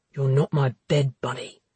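deep, cold male voice; hard, accusing low voice; low brooding man. Acted. Connor voiced in dialogue.

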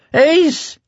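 high, desperate cry to Ace; frantic babbling. A narrator's reading. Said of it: "Hector was babbling".

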